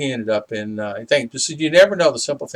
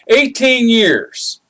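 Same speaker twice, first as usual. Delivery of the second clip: "Eighteen years" drawn out, high and loud; emphatic amazement; 11 semitones higher, 6 dB louder, much slower than usual. Real, not acted. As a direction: punch "Eighteen years" slowly at a raised pitch. Stressing how long ago it was.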